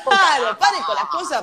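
laughing mock protest; laughing plea. Real, not acted. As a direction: beg her to stop through laughter. Joking scolding co-host, laughing.

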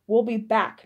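the widow promising to return, loud and menacing; threatening promise. A narrator's reading. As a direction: promise revenge loud and clear. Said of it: "Miss Aldehyde promised".

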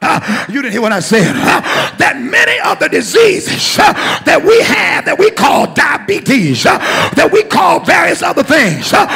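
ringing call-and-response sermon; preaching. Acted. Pastor leading congregation call and response, rousing preaching. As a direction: drive each point home, then call for the answer.

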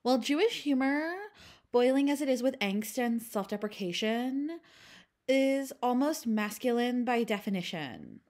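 mock it like a Valley Girl. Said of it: "I will be reading these quotes in a Valley Girl voice".

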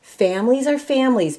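plain reading voice

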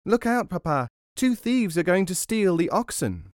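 put-on high-pitched child's warning; tiny boy raising the alarm; little boy calling out. A narrator's reading. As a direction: call a warning to Papa in a small high voice. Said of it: "called out as loudly as he could".

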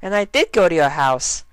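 high pony voice with a smirk; very high, a little proud; high smug Derpy. Acted.

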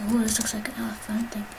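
hushed whisper to himself. Real, not acted. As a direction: breathe it out, unvoiced. Discovering arcade game.

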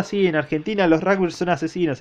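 normal talking voice

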